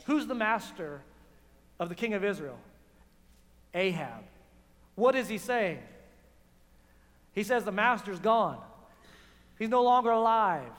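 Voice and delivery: usual speaking voice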